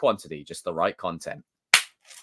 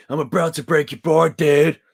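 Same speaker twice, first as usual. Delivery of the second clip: loud, higher put-on American dude accent; 7 semitones higher, 7 dB louder than usual. Shown as a demonstration. Streamer twists the line he read, loud American dude accent. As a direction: blurt it as a cartoon American board dude. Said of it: "do more American accents".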